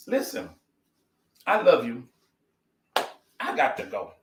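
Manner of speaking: usual speaking voice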